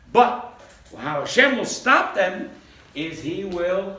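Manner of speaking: ordinary talk, nothing marked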